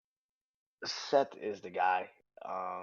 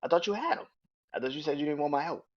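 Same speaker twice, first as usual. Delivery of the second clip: high, louder, indignant complaint; a higher, louder retort; mock Jey Uso whine; 6 semitones higher, 4 dB louder, faster than usual. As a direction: whine it like a brother who got ignored. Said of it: "Jey Uso says".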